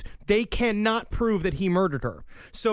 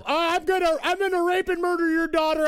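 mock yelling; 9 semitones higher than usual. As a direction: blurt it out as a hammed-up noisy intruder. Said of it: "can you keep it down".

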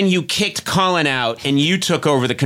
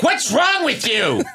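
high, drawn-out indignant demand; hammed-up exasperation at someone; mock outrage; 7 semitones higher, slower than usual. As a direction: imitate someone losing patience, slow and high. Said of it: "you were like".

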